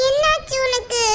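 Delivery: ordinary delivery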